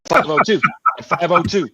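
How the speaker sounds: high, loud, laughing through the words. Real, not acted. Drafter on the clock breaking up, laughing. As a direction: crack up while calling out the number. Laughing after another's sudden yell.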